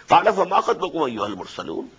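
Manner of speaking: rapid Quranic recitation; quick recitation. Acted. Teacher reciting the verse quickly before explaining. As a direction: rattle off the verse briskly.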